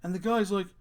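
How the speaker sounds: normal talking voice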